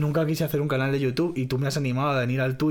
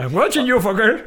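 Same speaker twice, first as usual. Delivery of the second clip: much higher, louder mock De Niro threat; De Niro impression; 8 semitones higher, 5 dB louder than usual. Shown as a demonstration. Showing off De Niro impression again, louder. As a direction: push the menace up hard. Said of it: "Por ejemplo".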